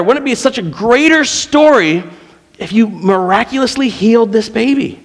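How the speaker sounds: retold yelling at God. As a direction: rage at God over the dying baby. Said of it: "I was yelling".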